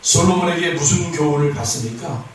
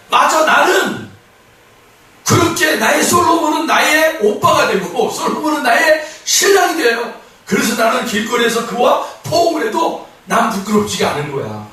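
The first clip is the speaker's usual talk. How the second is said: confident; 6 semitones higher than usual. Acted. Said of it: "그렇게 당당한 거예요".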